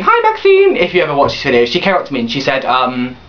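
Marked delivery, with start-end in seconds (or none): 0.0-0.8 high, slow sing-song hello; chirpy high hello to a friend watching; sing-song greeting. Real, not acted. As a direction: call out hi in a lilting high voice.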